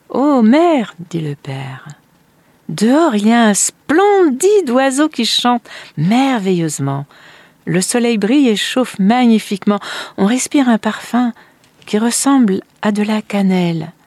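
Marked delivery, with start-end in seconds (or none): 0.1-0.9 loud, high exclamation; delighted father. Acted. Father exclaims to mother on hearing the bird, delighted. 2.7-13.9 high, loud, swooping wonder; enraptured father. Acted. Father tells mother of the splendid bird, enraptured.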